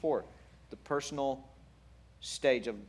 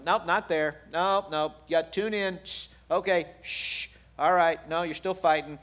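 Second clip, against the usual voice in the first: mock correcting; 4 semitones higher, 7 dB louder than usual. God fine-tuning, acted as interruptions.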